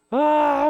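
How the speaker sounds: loud, high imitation wail; hammed-up bawling cry; mock crying wail. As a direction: wail like a student bursting into tears. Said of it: "더 울기 시작을 했었는데".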